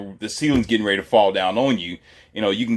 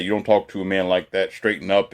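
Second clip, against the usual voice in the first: usual speaking voice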